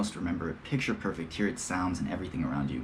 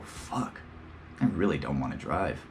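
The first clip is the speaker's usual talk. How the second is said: muttered; 5 semitones lower, 5 dB quieter than usual. Real, not acted. Muttered complaint.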